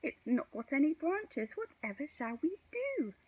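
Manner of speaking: high, worried whine; fretful. A narrator's reading. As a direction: despair loudly at no branches. Stu frets at the tree.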